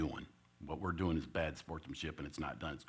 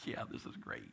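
hushed, breathless whisper of delight; kids whispering; 10 dB quieter than usual. Retold or imitated. Giggling players whispering about the plan, soft and thrilled. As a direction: whisper it like kids who can't believe their luck.

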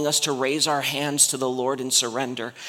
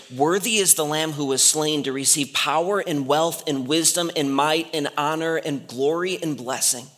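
ordinary talk, nothing marked

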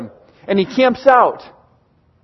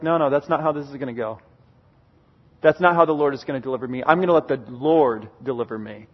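calm refusal; 7 semitones lower, 6 dB quieter, faster than usual. Retold or imitated. David calmly refuses to kill Saul.

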